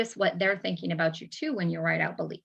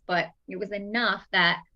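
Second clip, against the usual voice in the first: normal talking voice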